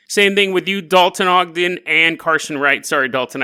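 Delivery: ordinary talk, nothing marked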